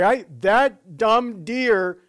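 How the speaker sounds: high, loud rattled rant at the deer; mock-furious shaken driver; shaken outburst. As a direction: yell at the deer after the near miss. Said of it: "And you're like".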